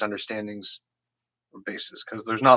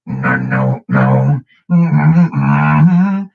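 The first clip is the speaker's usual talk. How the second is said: throat bass; 5 semitones higher, 9 dB louder, much slower than usual. Shown as a demonstration. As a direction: push the words out through a tight throat growl. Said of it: "You can do it the tight way".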